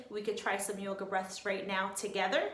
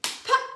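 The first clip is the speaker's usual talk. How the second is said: much higher, much louder burst on pop; balloon pop; 14 dB louder than usual. Shown as a demonstration. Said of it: "Fill up nice and big and".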